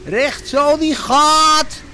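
high, loud, drawn-out call; skipper's shout. A narrator's reading. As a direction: bellow it slowly like a skipper at the helm. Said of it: "riep hij".

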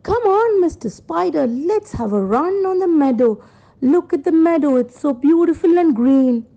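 a hint of an eager little sheep. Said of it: "The sheep said to the spider".

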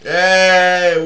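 loud, high burst of joy; real cheer. Real, not acted. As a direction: celebrate finally beating the mission. Cheers after finishing mission.

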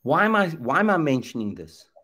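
normal talking voice

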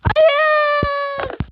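a loud crying no, high up; a real cry of defeat; despairing shout. Real, not acted. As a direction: wail it out as the run is lost. Falls after a hard section.

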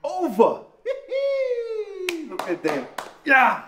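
loud, high shouts of triumph; triumphant cheering. Real, not acted. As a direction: cheer the ball over the posts. Cheering a kick over.

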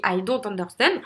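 English words in a heavy French accent; rotten French accent. Shown as a demonstration. Coach showing bad accent is still understood, French accent. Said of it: "bien que l'accent soit pourri".

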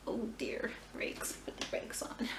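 hushed aside to herself; whisper. Real, not acted. Catching herself on camera, whispered. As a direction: drop to a whisper to rein yourself in. Stopping herself mid-sentence.

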